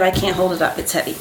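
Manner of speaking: ordinary talk, nothing marked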